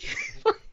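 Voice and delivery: a high squeal of laughter; high burst of laughter. Real, not acted. Laughing at the kid's taunt, a high squeal of laughter. Laughing at his own story.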